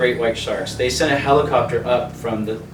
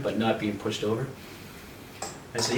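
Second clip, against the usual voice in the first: ordinary talk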